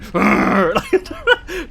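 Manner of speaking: loud, high growling roar; mock rage roar. Kid kicking at the door his sisters hold shut, mock furious roar. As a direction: roar like a raging kid, played for laughs. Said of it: "in my, like, anger rage as a kid".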